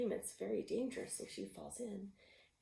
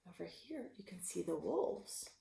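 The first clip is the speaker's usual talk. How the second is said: ordinary delivery, nothing marked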